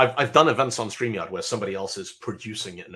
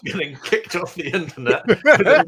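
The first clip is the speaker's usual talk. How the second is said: mock show host; 5 semitones higher, 6 dB louder than usual. Mock show opening, laughing.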